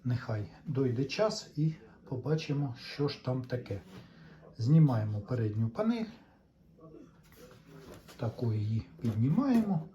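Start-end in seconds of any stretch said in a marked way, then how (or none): none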